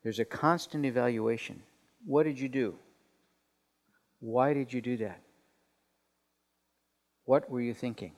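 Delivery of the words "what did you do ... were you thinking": mild, subdued reproach with long pauses between questions; softer, measured questions, heavy with disapproval; disappointed mother. Retold or imitated. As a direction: imitate a disappointed mom questioning her child. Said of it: "disappointed sort of a sound".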